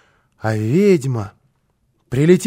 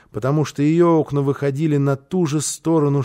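usual speaking voice

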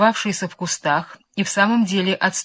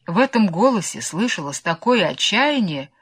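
ordinary delivery, nothing marked

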